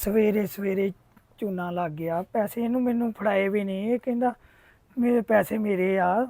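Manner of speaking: soft, dejected talking to oneself; resigned grumbling. Acted. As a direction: grumble quietly over being cheated. Cheated shopkeeper grumbles to himself. (ਹੌਲੀ ਆਵਾਜ਼ 'ਚ ਮਾਯੂਸ ਸ਼ਿਕਾਇਤ)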